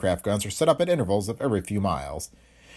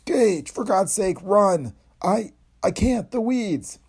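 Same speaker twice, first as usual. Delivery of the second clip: high, strained, halting plea; feeble desperate cry; 10 semitones higher, much slower than usual. A narrator's reading. Professor overcome by madness begging assistant to run, feeble cry. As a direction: force out a faltering warning, slow and high-pitched. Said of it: "Calthay's cry was a feeble whisper".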